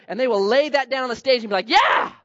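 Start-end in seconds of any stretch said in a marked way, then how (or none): 1.7-2.1 high, loud triumphant yeah; preacher mimicking a flashy donor, loud yeah; mock cheer. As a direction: shout it like a showy giver. Givers cheering at the stage.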